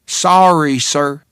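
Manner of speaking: put-on thick Texas accent, friendly waitress. A narrator's reading. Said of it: "in a Texas accent that was thick enough to cut".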